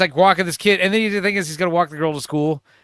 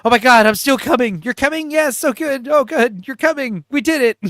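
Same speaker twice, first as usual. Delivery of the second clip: high, hammed-up triumphant lovers; comically elated mimicry, pitched up; mock climactic excitement; 7 semitones higher, 5 dB louder than usual. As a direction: cheer each other on in mock passion. Retold climax, mutual celebration.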